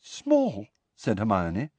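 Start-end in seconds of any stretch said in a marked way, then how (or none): 0.0-0.7 high, contained disbelief, falling; incredulous echo. A narrator's reading. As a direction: repeat it in small, high disbelief. Incredulous echo, first take.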